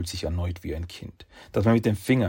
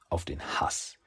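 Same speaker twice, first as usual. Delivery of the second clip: whispered; 5 semitones lower, 7 dB quieter than usual. A narrator's reading. Narrating hatred.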